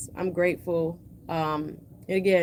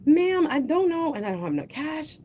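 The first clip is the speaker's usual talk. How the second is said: flustered panic; 8 semitones higher than usual. Retold or imitated. To the cashier, flustered about the missing card. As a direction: stammer it out, embarrassed at the till. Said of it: "I'm like".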